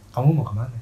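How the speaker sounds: put-on low soft boyfriend voice. Shown as a demonstration. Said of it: "Kayak gini".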